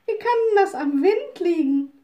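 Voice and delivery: high, loud surprised question; little witch calls out in disbelief; astonished call. Acted. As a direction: witch can't believe the wind is to blame. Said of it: "rief die kleine Hexe".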